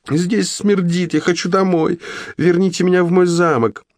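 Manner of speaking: high, haughty, whiny demand; spoiled princess. Acted. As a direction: play a pampered royal captive. Captive queen demanding to leave.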